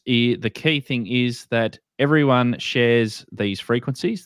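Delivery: ordinary talk, nothing marked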